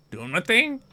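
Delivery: high, lilting character voice; evasive prisoner cousin. Acted. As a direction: dodge it in a high voice.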